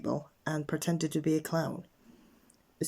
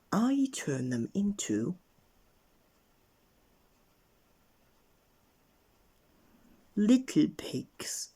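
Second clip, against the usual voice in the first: dramatic threat; 6 semitones higher, slower than usual. Acted. Wizard warns Mister Grumble, slowly and building. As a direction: draw out the threat like a wizard casting a spell.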